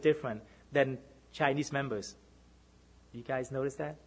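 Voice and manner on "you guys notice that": soft aside. Acted. Softer rhetorical question.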